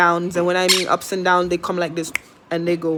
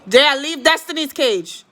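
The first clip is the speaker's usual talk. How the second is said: calling out; 7 semitones higher, 5 dB louder than usual. Real, not acted. Scolding child near cage.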